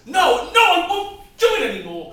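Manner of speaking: loud, high outburst; husband shouts that he won't do it anymore; shouting. Acted. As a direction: yell your refusal at full force. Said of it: "Keep your voice down".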